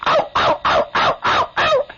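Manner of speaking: high yappy corgi yelps; little dog yapping, ending in a squeak. Retold or imitated. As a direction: yip in a tiny dog's squeal. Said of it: "he goes".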